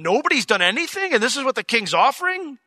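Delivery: high-pitched baffled questioning; baffled disbelief. Retold or imitated. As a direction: act out someone who can't believe it. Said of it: "he doesn't understand".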